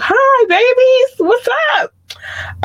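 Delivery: slow, high falsetto coo; squealed greeting. Real, not acted. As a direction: squeal hello to your darlings. Cooing greeting to listeners abroad.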